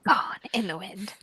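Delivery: soft unvoiced whisper. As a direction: breathe it out like a ghost. Dramatic whisper.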